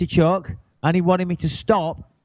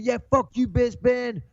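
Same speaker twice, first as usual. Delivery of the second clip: imitating a higher-pitched angry jeer; retold abuse; 7 semitones higher than usual. Rude fan jeering at Bisping walking away, mock angry yell. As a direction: toss the insult back as the rude fan. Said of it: "he started shouting abuse".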